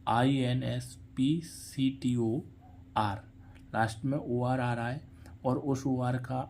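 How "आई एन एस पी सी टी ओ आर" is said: letters spelled out slowly one by one; slow letter-by-letter spelling. Shown as a demonstration. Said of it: "inspector".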